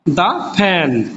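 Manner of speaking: the fan with "the" as "da". Shown as a demonstration. Teacher repeating the "da" form for students. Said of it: "উচ্চারণটা জেনে শুনে বুঝে".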